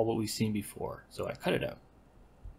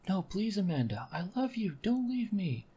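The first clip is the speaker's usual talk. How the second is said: much higher, begging lover; high pleading voice; 10 semitones higher than usual. Acted. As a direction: plead high and desperate not to be left. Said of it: "giving them the same voice".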